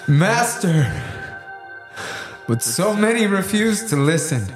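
high, urgent protest to a master; anguished disciple appealing upward; distressed plea. Acted. Andrew pleads with his Lord.

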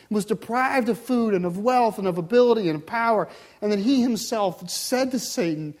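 loud, driving preacher's build; forceful preaching. Real, not acted. Preacher urges drawing closer to the Lord, forceful preaching. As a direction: press on urgently to the congregation.

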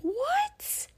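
very high, breathless what; stunned disbelief. Retold or imitated. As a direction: squeak it out in disbelief. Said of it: "And I was like".

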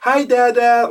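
put-on very high, loud child's cry of hi Dada; toddler shout. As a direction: shout it like a delighted toddler across a crowd. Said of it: "Nova shouts".

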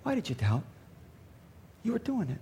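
hushed, gentle reproach; private aside. Acted. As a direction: speak very softly, for his ears only. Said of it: "just a little bit privately".

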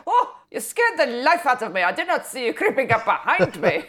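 laughing, hammed-up German officer; mock German accent. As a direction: do a silly startled German leader. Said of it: "pair the description with the accent".

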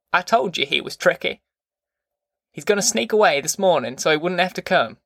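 understated disgust in a grandmother's voice; disgusted grandma. A narrator's reading. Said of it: "she said disgustedly".

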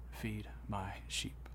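slow, hushed whisper; very soft, deliberate, solemn command; whispered charge. Retold or imitated. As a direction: whisper it word by word. Jesus's solemn final charge to Peter.

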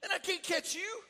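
high, loud alarmed cry; father yelling in alarm as his son runs at him, high and loud; startled yell. Retold or imitated. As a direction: pull back suddenly in alarm. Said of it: "I said".

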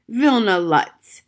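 loud, slow shout of the name. Acted. Gloria shaking her fist at the ceiling, shouting his name. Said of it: "she shouted".

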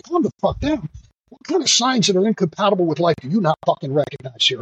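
high-pitched retort, retold outrage; re-enacted indignant tirade; indignant, self-righteous. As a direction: snap back incredulously, pitch leaping then easing. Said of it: "that indignant, self-righteous bullshit".

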